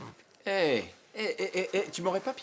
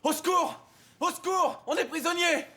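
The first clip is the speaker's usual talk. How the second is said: high-pitched cries for rescue; calling for help; 13 semitones higher than usual. Acted. Prisoner calling to anyone outside, shouting for help. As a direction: yell out high, desperate to be found.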